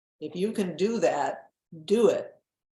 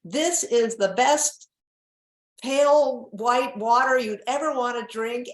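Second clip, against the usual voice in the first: higher, louder and slow, like a TV spokesmodel; hammed-up ad read; 7 semitones higher, 5 dB louder than usual. Model rehearsing a commercial line, hammed-up ad voice. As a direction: sell the water line with mock sincerity. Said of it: "memorizing lines like".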